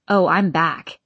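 ordinary delivery

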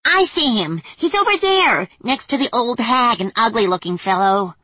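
high, excited little fairy; squeaky fairy spying. Acted. Voices fairies.

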